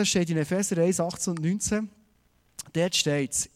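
ordinary talk, nothing marked

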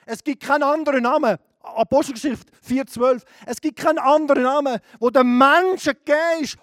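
loud, insistent pulpit voice; full-force sermon proclamation; fiery preaching. Acted. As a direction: hammer the point home.

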